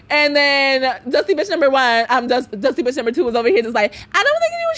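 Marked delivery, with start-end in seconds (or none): 4.1-4.8 mimicking roommate.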